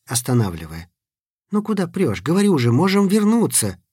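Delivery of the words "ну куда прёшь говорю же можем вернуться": nervous high urging. A narrator's reading. Nervous boy stopping friend.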